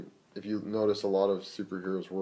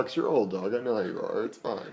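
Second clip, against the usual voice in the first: normal talking voice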